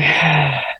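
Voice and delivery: a settling sigh as the tension eases. Real, not acted. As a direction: let the breath go with a sigh. Calming herself between breaths.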